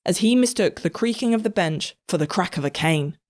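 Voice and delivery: usual speaking voice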